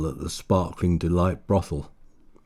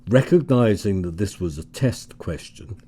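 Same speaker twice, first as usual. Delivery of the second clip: usual speaking voice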